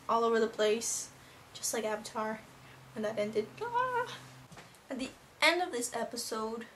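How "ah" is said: high-pitched fangirl wail; mock wail. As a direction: cry out in mock despair, high. Retold fan reaction to ending.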